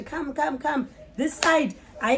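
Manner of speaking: normal talking voice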